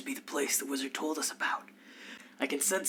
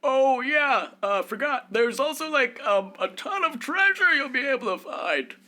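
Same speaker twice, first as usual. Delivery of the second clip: put-on old wizard; 14 semitones higher, 6 dB louder than usual. Game master voicing the wizard pitching a quest, airy and high. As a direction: play the wizard as a flaky valley-talker, pitched way up.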